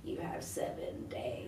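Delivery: whispered creepy horror voice; low ghostly whisper to a prank call victim; Samara from The Ring. Retold or imitated. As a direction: breathe it down the phone like a ghost. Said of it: "ring people and go".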